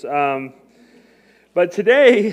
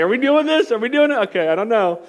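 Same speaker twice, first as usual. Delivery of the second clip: pitched way up and louder, giddy; higher, louder imitation of eager self asking twice; mock-excited disbelief; 6 semitones higher, 5 dB louder than usual. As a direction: ask it like an excited kid who can't believe it. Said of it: "I was like".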